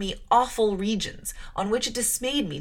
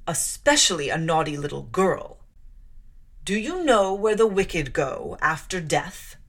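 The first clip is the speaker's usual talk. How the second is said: stern preaching; 4 semitones lower, 3 dB louder than usual. Acted. Clergyman lectures the child sternly.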